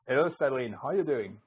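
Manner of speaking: ordinary delivery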